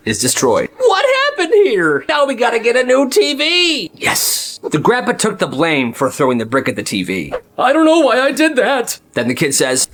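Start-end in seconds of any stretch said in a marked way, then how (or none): 0.8-3.9 shocked mom. Retold or imitated. 7.6-9.0 higher, sheepish fake apology; mock innocent grandpa.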